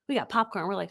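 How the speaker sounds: normal talking voice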